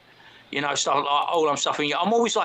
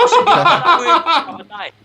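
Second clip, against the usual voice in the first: laughing; 12 semitones higher, 10 dB louder, slower than usual. Real, not acted. Laughing while starting story.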